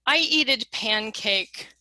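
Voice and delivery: slow, deliberate learner error; slow example. Shown as a demonstration. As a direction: read the error out slowly. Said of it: "you need to step back and say".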